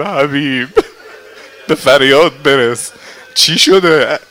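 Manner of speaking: loud, high plea for help; urgent call. Acted. Nafe rushing to Habib, loud urgent plea.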